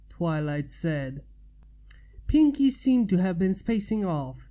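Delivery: usual speaking voice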